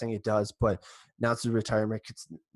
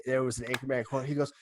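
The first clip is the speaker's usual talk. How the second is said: normal talking voice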